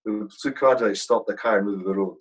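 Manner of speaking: ordinary delivery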